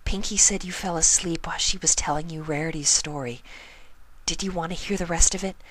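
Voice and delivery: a gentle friend offering the rest of the story; young pony voice. Acted. Narrator voices a pony character.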